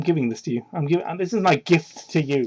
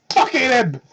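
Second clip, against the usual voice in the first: ordinary delivery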